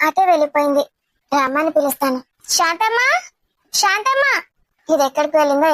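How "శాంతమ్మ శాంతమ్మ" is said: raised, rising call for the maid. Acted. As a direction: call out to find someone, lifting the end. Calling the maid, who is elsewhere. (పనమ్మాయిని పిలుస్తున్నట్టు స్వరం పెంచి)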